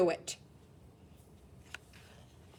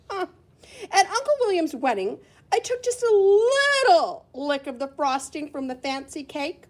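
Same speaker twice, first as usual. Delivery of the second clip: usual speaking voice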